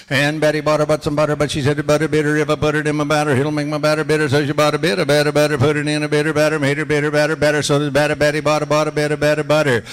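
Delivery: loud and fast, rattling the twister off; fast tongue twister. Shown as a demonstration. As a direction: speed through it at full voice. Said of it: "we'll do a couple Betty Botters".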